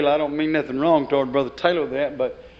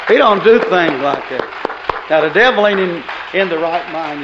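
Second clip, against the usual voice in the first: preaching shout; 8 dB louder than usual. Real, not acted. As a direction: hammer it home to the congregation. Preacher proclaiming to congregation.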